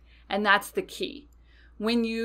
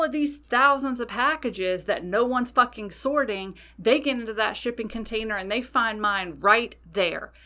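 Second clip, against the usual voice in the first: heated, swearing rant with raised pitch; angry; 4 semitones higher, 3 dB louder than usual. Retold or imitated. Angry customer challenging the universe at the altar, angry. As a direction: fume and hammer the key words. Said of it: "I was angry, y'all, okay?".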